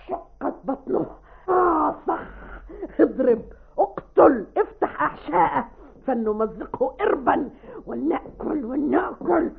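fierce stage declamation with bursts of shouting; violent, clear delivery. Shown as a demonstration. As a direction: act out a savage killing, punching each command. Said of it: "بعنف شديد وأداء واضح".

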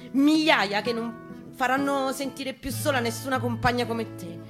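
usual speaking voice

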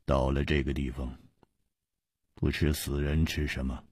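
low, quiet and icy; cold reply. A narrator's reading. Masked man coldly answers Hu Tiehua, low and icy. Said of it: "冷冷地说".

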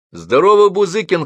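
бодрый окрик, громко и высоко; крикни приветствие знакомому издалека. A narrator's reading. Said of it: "крикнул он". (громкий окрик)

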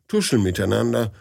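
usual speaking voice

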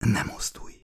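soft whispered command. A narrator's reading. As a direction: breathe the order under your voice.

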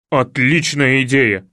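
loud, slow, approving man's voice; одобряющий Илья. Acted. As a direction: approve the plan slowly and heartily. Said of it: "одобрил Илья". (громко, медленно, одобрительно)

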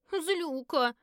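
put-on high, hurt child voice; little girl's gasp. A narrator's reading. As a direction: gasp it like an offended little girl. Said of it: "охнула Лилу". (обиженно охнуть, как маленькая девочка)

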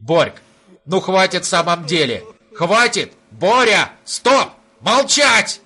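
rising to a high loud yell; losing patience. Acted. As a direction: build from pleading to yelling. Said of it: "теряя терпение".

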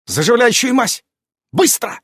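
high, urgent order; sharp command. A narrator's reading. Irene orders healing ointment, sharp and urgent. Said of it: "резким тоном распорядилась Ирен".

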